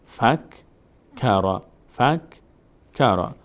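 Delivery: کرا and فک spaced out slowly; slow syllable demo. Shown as a demonstration. («کرا، فک» آهسته و جدا جدا)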